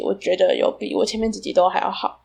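ordinary delivery

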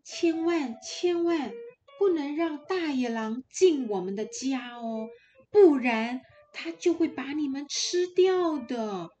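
put-on caring mother goat, warning her kids, bright and urgent; a loving mother pressing a warning on her little ones; mother goat's warning. Mother goat telling her kids to beware the wolf, earnest and urgent.

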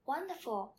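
usual speaking voice

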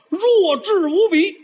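high, slow mocking drawl; high slow jab at the dim-witted monster; mock idiot. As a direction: stretch it out, poking fun.

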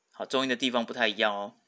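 usual speaking voice

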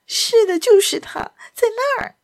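high, urgent woman's voice; frightened. Acted. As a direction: point him out in a panic. Frightened lady pointing out the villain.

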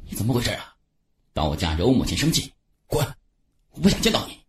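curt, harsh order to leave; hostile scolding. A narrator's reading. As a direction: drive him out with contempt. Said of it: "马鹏飞恶言相加".

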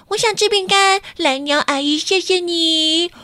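high eager chick voices; little chicks. A narrator's reading. Little lovebirds begging aunt Bluebird for cookies, high eager. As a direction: squeak it like hungry baby birds. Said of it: "三只小爱情鸟马上就说".

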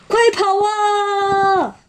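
very high, stretched-out getaway call; gleeful storybook escape cry; fleeing cry. Acted. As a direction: call the gang to bolt. Wolves shout to run away.

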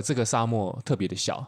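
usual speaking voice

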